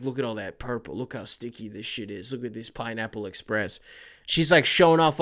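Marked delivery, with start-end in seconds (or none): 0.0-3.8 much softer, lower stoner murmur; hushed show-off. Retold or imitated. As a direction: quietly brag over the goods.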